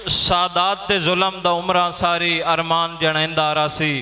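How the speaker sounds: poem recitation. Acted. Poet recites verse at gathering.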